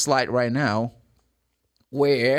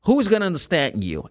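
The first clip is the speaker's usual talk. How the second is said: usual speaking voice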